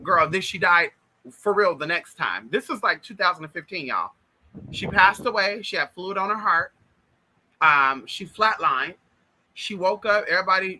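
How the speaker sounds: normal talking voice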